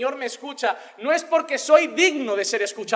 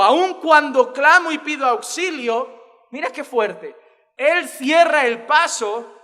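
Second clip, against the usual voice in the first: slow, louder, higher lament read; dramatic scripture reading; 3 semitones higher, 7 dB louder, slower than usual. Acted. Preacher reading Lamentations aloud, slow and heavy. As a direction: read each line like a heavy cry.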